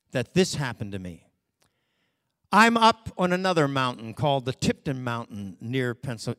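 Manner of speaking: ordinary talk, nothing marked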